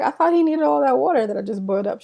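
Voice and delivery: high indignant question. Retold or imitated. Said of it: "I was like".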